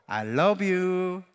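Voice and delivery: slow, tender "I love you"; tender demo. Shown as a demonstration. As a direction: lead the congregation in a loving line. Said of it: "마지막으로". (천천히 다정하게 말하는 "I love you")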